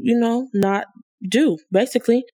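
ordinary delivery

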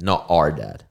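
ordinary delivery

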